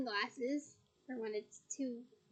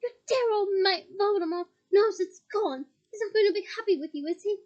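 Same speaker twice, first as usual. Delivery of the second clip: loud, higher, mocking taunt; 6 semitones higher, 9 dB louder than usual. Harry mocking Bellatrix about Voldemort, loud taunt. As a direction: jeer at her, rushing the threat.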